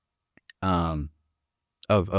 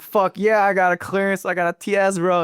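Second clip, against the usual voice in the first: eager yes; 10 semitones higher than usual. Retold or imitated. Narrator accepting Ernest's job offer, excited and higher. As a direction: jump at the offer, pitched up. Eager boast about clearance.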